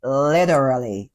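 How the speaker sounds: drawn-out mock American drawl; slow-mo American accent. Mocking Emily's use of literally, slow American accent. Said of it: "in a sort of slow-mo American accent".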